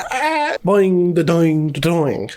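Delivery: short yelp, then bouncing boings stepping down in pitch; cartoon falling noises. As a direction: imitate a comic tumble, boing by boing. Imitating the fall down a mountain.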